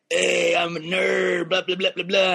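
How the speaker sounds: droning caricature of a nerd comic; mocking nerd. Comic fed up with nerd culture material, mocking imitation. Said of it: "I just don't like the nerd culture stuff".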